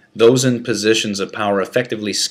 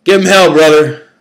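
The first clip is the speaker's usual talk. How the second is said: loud, higher holler; 8 semitones higher, 12 dB louder than usual. Acted. Another inmate hollers support at the prisoner, loud holler. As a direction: holler it down the tier. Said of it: "hollered another".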